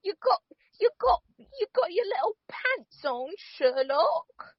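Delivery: put-on very high, teasing British accent; Martin Freeman impression. Cohost as John teasing Sherlock, high British accent. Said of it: "That's your Martin Freeman impression?".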